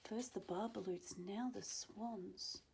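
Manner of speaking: usual speaking voice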